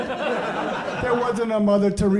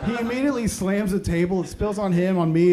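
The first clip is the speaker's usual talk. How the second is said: normal talking voice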